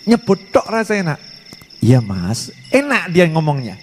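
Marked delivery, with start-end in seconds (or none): 1.8-2.5 lightly voiced new bride. New bride tenderly calling her husband, lightly voiced. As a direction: coo the husband's name gently. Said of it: "di mulutnya enak".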